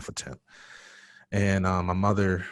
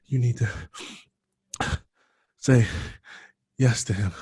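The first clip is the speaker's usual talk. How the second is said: winded grandmother, higher and in short bursts; acted panting, breaking for air; imitating out of breath; 3 semitones higher, 3 dB louder than usual. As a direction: gasp out a few words at a time. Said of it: "she's out of breath".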